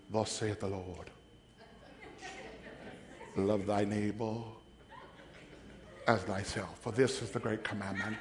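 mock the syrupy movie Jesus; parodying a film Jesus, softly and reverently; smooth holy Jesus. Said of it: "and he's so smooth".

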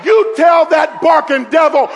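loud, high shouted preaching; preaching at full force. Acted. Preacher shouting to the church, full force. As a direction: proclaim it to the congregation.

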